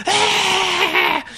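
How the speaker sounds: high-pitched retold scream; imitated scream. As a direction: mimic a child's long screech. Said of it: "just screaming, like".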